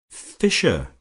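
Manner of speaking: choked, high, loud cry of a name; strangled cry. Acted. March cries out to Fisher in shock, strangled. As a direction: force the name out through a tight throat. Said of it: "cried his friend in a strangled voice".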